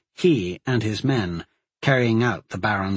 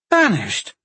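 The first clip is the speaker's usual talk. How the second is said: a higher, shocked cry of disbelief; shocked shout; 9 semitones higher, 3 dB louder than usual. Acted. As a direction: throw the word back at him in outrage. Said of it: "the Bladewells shouted together".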